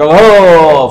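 drawn-out exultant call; acted joyful rallying cry; slogan cry. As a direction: let out a long, loud cheer. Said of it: "وہ ایک نعرہ لگائے گا".

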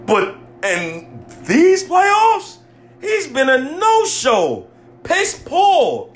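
high, exasperated outburst; real angry rant. Real, not acted. Fan venting about the player's no-show playoffs, angry rant. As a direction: rant with pitch flying up. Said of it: "if I'm screaming on this".

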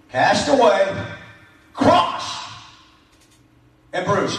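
firm, punched words with long pauses; emphatic preacher's demonstration; with conviction. Shown as a demonstration. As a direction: declare each word like you mean it. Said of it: "See how I said it with some conviction".

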